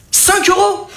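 high put-on vendor voice; squeaky little vendor answering the price.

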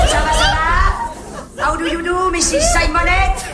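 slow, high sing-song hellos; stretched sing-song social voice; gushing greeting. Acted. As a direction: greet everyone with drawn-out showy delight. Gushing greetings to waiting friends.

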